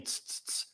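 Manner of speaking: "tss" as short hissed bursts; isolated T sound. Shown as a demonstration. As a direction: tap out "tss" with the tongue tip, no vowel. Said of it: "instead of now saying".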